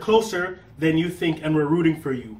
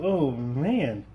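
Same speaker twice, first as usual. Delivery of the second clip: ordinary talk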